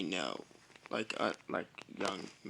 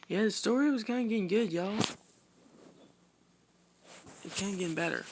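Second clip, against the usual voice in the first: high, fast, loud burst of excitement; excited; 9 semitones higher, 4 dB louder, much faster than usual. Real, not acted. Storyteller hyped about his own story, fast and high. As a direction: gush about how good the story is.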